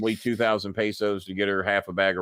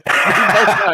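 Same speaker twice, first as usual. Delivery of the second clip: laughing; 8 semitones higher, 9 dB louder, slower than usual. Real, not acted. Laughing at shared lie.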